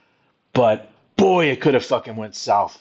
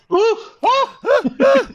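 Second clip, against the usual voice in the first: very high whooping cheer; high laughing woo; 4 dB louder than usual. Real, not acted. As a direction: whoop like a wrestling fan. Cheering for the title.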